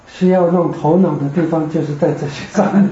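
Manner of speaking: ordinary talk, nothing marked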